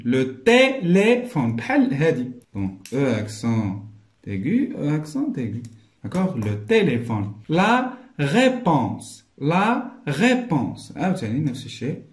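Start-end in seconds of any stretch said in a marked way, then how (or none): 0.0-1.5 "téléphone" slow and higher, each é stretched; syllable-by-syllable model. Shown as a demonstration. Teacher repeats the slow model. 7.5-10.9 "réponse" very slow and higher, with the é held; slow teaching model. Shown as a demonstration. Teacher models the accented syllable.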